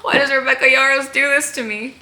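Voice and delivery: squeaky, high "Huh?" with a laugh in it; mock bewildered. Fan reacting to the author's twist, laughing in mock bewilderment. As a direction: play up the shock of the twist, laughing. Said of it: "I was like".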